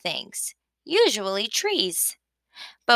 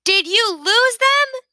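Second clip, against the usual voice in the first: much higher and louder, almost squeaking; squeaky accusing Sheep; 13 semitones higher, 5 dB louder than usual. Acted. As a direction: squeak it out like an outraged little sheep.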